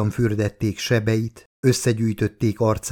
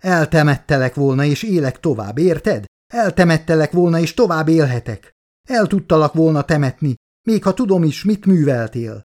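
high, raised-voice outburst; anguished shouting; 6 semitones higher, 4 dB louder than usual. A narrator's reading. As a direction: cry it out at the listener in pitched-up reproach. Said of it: "Rám kiáltott".

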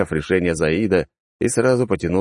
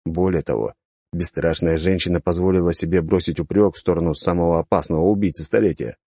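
ordinary delivery, nothing marked

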